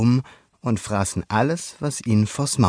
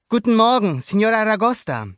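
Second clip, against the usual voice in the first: put-on high, loud boyish hello; boy greeting; 12 semitones higher, 5 dB louder than usual. A narrator's reading. Luca greets a neighbor while swimming past, high voice. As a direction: greet her as young Luca.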